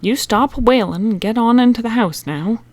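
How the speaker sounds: firm, sternly scolding call; a mother's no-nonsense order. A narrator's reading. Mother ordering Bobby into the house, stern. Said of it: "say sternly".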